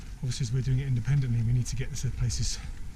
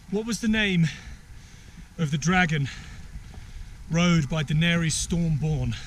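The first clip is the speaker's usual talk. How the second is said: higher, slowing quizmaster delivery; quiz host; 5 semitones higher than usual. Pretend. Host quizzing friends on the dragon's name, quizmaster voice. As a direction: draw out the question in separate phrases.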